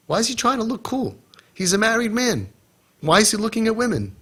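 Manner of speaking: high, indignant retold objection; indignant complaint. Kids complaining to him about the volunteer, high indignant mock objection. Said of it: "They all told me".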